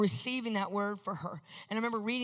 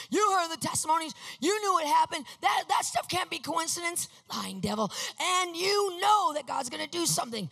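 higher, louder preaching to his mother over the phone; 6 semitones higher, 4 dB louder than usual. Retold or imitated. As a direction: preach it hard to lift her up, with a shouted "Lying devil". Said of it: "now I'm preaching to myself".

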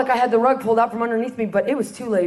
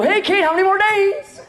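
eager question; 7 semitones higher than usual. Retold or imitated. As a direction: call out cheerfully to Kate. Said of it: "and they go".

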